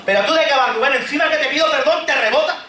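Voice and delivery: acted flare of temper at a friend; indignant retort. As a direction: snap back, offended you were rebuffed. Acted quarrel, snapping back at Rubén.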